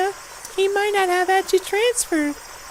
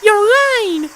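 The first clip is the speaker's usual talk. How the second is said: sharp accusation; 2 semitones higher, 7 dB louder than usual. Acted. Accuses again.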